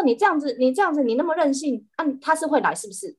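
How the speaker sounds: high, fast telling-off; scolding. Retold or imitated. The host fast scolds the cat for being so willful. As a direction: rattle off the reprimand. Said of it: "我就说".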